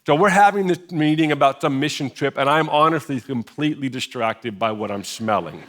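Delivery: normal talking voice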